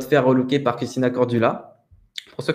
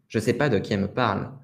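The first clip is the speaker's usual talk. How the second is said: ordinary talk, nothing marked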